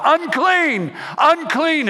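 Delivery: high, loud cry of unclean; drawn-out outcast's cry; yelled warning. Retold or imitated. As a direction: call it out like a leper warning the town. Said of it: "they would have to yell".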